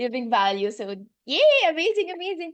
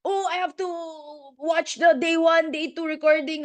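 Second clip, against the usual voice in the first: mock self-pressure; 4 semitones higher, 4 dB louder than usual. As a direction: act out someone fussing over homework. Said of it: "strict with yourself that".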